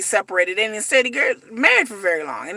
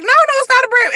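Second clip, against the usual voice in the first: Kimberly's protest; 13 semitones higher, 5 dB louder than usual. Retold or imitated. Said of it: "she's like".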